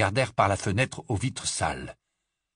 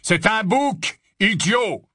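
high, scornful correction; growling; 10 semitones higher, 5 dB louder, much slower than usual. A narrator's reading. Barman correcting the Death Eater, growling. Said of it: "gronda le barman".

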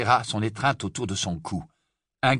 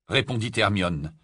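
plain reading voice

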